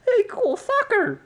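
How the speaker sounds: very high, squeaky mock-excited fan; excited fan impression. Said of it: "maybe one of you people are like".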